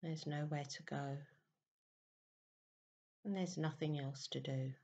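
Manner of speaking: very soft, unhurried meditation voice with long pauses; guided meditation. Real, not acted. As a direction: gently guide listeners into stillness, hushed and even.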